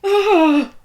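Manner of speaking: piercing high pained scream. Acted. Gregor screaming as Karen cuts him, high pained scream. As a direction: shriek as the knife goes in.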